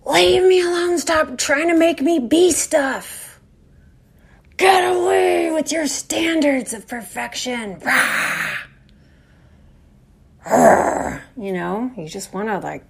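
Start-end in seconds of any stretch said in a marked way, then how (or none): 0.0-7.8 much higher, louder slow snarl; hammed-up furious outburst; inner monster rage. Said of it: "wants to be like". 7.8-11.2 two breathy growling roars; playful mock roar; monster roar.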